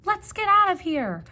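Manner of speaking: high, loud panicked cry; frightened cry. Acted. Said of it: "said the kittens".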